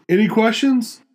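high sing-song question; mocking a chirpy boss. Boss asks the room, mock chirpy. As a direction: sing it out cheerily.

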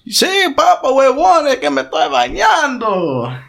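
high, sing-out Spanish reply; imitated shower housemate. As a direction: holler back from the shower in mock Colombian voice. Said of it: "he starts singing".

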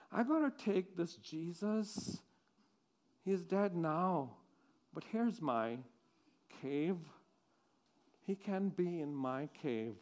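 low, hushed pleading; quiet plea. Acted. Speaking to the Roman authorities, hushed and pleading. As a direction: ask softly, almost to yourself.

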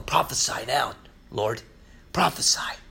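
low, soft sneering taunt fading to a whisper; hushed taunt. Retold or imitated. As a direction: hiss the mockery quietly. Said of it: "they would tell him".

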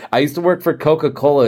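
usual speaking voice

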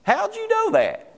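high-pitched incredulous question; retold astonishment; surprised retort. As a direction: jump up high in mock amazement. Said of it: "And I'm like".

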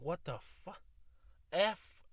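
a startled half-cussed outburst; retold shock. Mock shocked outburst at finding a red hair. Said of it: "I'm like".

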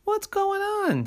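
put-on high puzzled boy's voice; baffled. Jimmy confused when the puppy doesn't appear. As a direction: sound confused the trick failed. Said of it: "said Jimmy".

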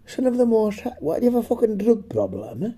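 high, outraged disbelief; incredulous. Real, not acted. Incredulous reaction.

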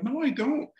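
high-pitched mock protest; jumping high in mild indignation; defensive retold denial. Retold denial to his wife.

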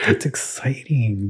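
normal talking voice

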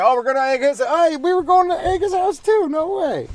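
high-pitched mock delight at a shared prank; mock excitement. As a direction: squeal it like thrilled kids. Excited other kids voiced.

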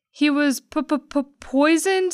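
high, slow stuttering disbelief; frightened stutter; stammering shock. Acted. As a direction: stutter in horror before fainting. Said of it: "She turned pea green".

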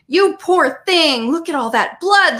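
high, loud, drawn-out wail; howling. A narrator's reading. Lily fusses over Riley and the blood, howling. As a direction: wail it in hammed-up horror. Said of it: "Lily howled".

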